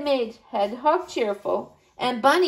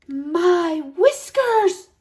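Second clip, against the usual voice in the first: breathless gasp; 5 semitones higher, 3 dB louder, much slower than usual. Acted. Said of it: "whispered Bunny".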